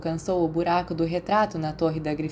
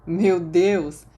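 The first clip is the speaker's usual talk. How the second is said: mock-surprised exclamation, a bit louder; faked surprise; 5 dB louder than usual. A narrator's reading. Remus pretends to be surprised by his friends' party, put-on surprise. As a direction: act surprised at a party you expected. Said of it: "esperando estar fazendo uma boa cara de surpresa".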